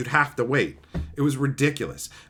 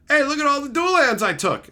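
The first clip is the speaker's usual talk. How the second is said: higher, louder boastful voice; mock bragging thief; 9 semitones higher, 4 dB louder than usual. Friend bragging about stolen lands, higher and louder. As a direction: play the proud thief. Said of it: "they're like".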